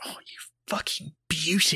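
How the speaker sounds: slow, whispered gratitude; relieved whisper. Retold or imitated. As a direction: whisper it like a secret celebration. Secret relief at friend's rescue.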